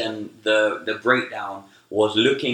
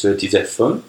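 mock Frenchman's accented English; deadpan commitment to a put-on French accent; 2 semitones lower, faster than usual. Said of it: "in a French accent".

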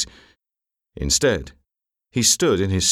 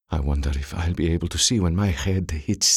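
subdued, inward musing; inner thought; 3 semitones lower, 3 dB quieter than usual. A narrator's reading. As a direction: wonder privately about your own end. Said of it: "Val Aldun thought".

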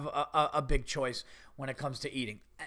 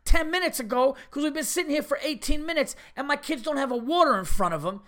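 high-pitched, louder indignant outburst; exasperated rant; 7 semitones higher, 3 dB louder than usual. Real, not acted. Telling the audience about the rude waitress, exasperated high rant. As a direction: vent the outrage, voice climbing high.

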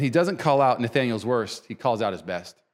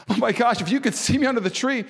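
acted astonishment, higher voice; Nathanael amazed; 8 semitones higher than usual. Preacher playing Nathanael realizing he was seen, higher and amazed. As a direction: react with mild higher-pitched amazement. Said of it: "Nathanael was responding, going".

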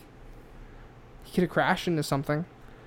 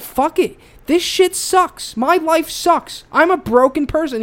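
pilot's despair; 10 semitones higher, 7 dB louder, slower than usual. Retold or imitated. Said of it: "one day he said".

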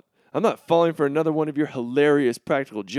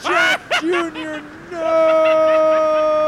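high, loud pleading shout; desperate scream; 11 semitones higher, 6 dB louder, much slower than usual. Acted. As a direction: scream after a falling friend. Friend falls.

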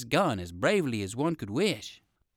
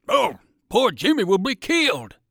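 higher and louder, alarmed; alarmed cry; 7 semitones higher, 7 dB louder than usual. A narrator's reading. Dog soldier crying out as the pony bolts, higher and louder. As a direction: cry out in fright for Jimmy. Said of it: "cried Percival".

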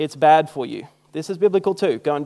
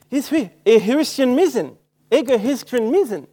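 put-on astonished girl; 8 semitones higher, 5 dB louder than usual. Girl at the counter answering in Turkish, astonished. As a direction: imitate her amazed question, pitched well up and slowed. Acts the surprised girl's reply.

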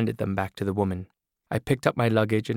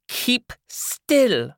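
higher, louder brassy woman's voice; loud, higher voice of a tough older woman; brash woman character; 4 dB louder than usual. Acted. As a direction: play the hard-bitten woman with the scissors. Woman barks an order.

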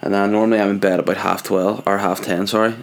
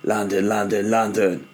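put-on London accent; 3 dB quieter than usual. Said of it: "Much better".

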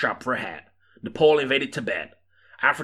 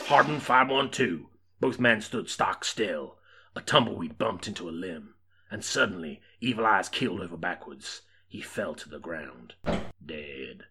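plain reading voice

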